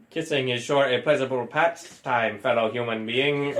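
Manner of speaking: low, stiff robotic human-imitator; alien robot voice. A robot praising the kiss to his date in a low, stiff voice.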